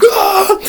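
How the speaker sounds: high yelp; high panicked yelp. Retold or imitated. Running hiker yelping in fear. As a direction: yelp as you run.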